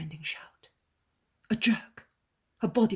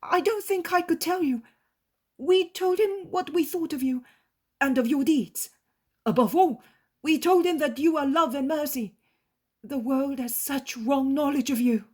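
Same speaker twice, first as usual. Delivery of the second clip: high, loud, devoted disciple voice; earnest disciple; 10 semitones higher, 11 dB louder than usual. Acted. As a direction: answer the master with eager devotion. Disciple speaks of master.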